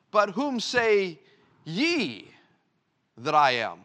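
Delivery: slow, with ye set apart and stressed. Shown as a demonstration. Preacher stressing ye to his church. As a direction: lean hard on ye, pause around it. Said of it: "The emphasis here is on the word ye".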